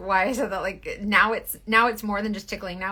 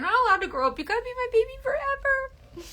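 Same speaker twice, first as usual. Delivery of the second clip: put-on high, babying sing-song; baby your son; doting mom. Mom telling son never grow up, high doting voice.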